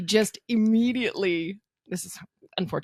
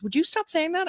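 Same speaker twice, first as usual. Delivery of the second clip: pitched up, pleading to stop; exasperated plea; 11 semitones higher than usual. Retold or imitated. Friend begging her to stop the rumors, exasperated. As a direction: beg him to drop the joke.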